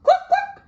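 very high, loud double cluck; high-pitched hen noise; chicken cluck. Acted. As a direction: squawk like a storybook hen. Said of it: "said Chicken".

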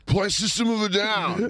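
high mock yell from a stoned caller. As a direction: bark it like a wasted rock fan. Meth head caller request.

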